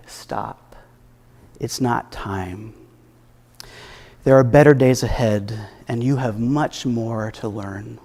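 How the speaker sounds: hushed, whispered start, then soft and slow; gentle urging. Retold or imitated. Inner voice stopping her on a desperate night, hushed and gentle. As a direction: breathe it like a quiet voice stopping her. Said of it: "the same gentle urgings from the same voice".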